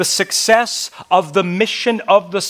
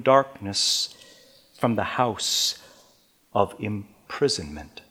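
scripture reading; 3 semitones higher than usual. Acted. Preacher reads the Lord's words to the servant, raised then hushed. Reads verses six and seven.

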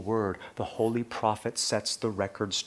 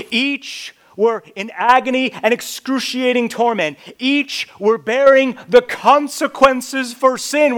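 climbing louder and higher to a peak; preaching at full force; 12 semitones higher, 14 dB louder than usual. Acted. Preacher picturing the three crosses for his beloved, building loud. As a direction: paint the crucifixion with mounting force.

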